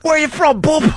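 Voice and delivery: put-on very high, silly English accent; mock English accent. Host quizzing the intern in a high mock-English accent. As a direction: question him in a cartoon English accent.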